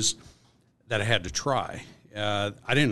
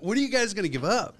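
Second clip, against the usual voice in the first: exasperated, higher-pitched retelling; retold exasperation; 10 semitones higher, faster than usual. Worker fed up with his bosses' prank, exasperated. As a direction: pitch it up, fed up with their games.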